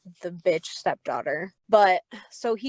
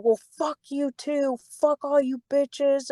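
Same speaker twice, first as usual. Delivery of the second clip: higher-pitched imitation of an angry boss; retold outburst; 6 semitones higher than usual. Boss cursing out the staff as he storms off, retold outburst. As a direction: act out his rant, pitched up. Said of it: "he went hard".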